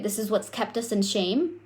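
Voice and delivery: ordinary delivery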